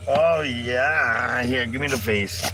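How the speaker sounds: ordinary talk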